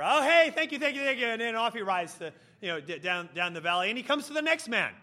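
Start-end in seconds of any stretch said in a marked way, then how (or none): 0.0-1.3 hasty polite brush-off. Acted. Knight hastily cuts the boaster off.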